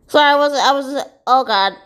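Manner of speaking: ordinary talk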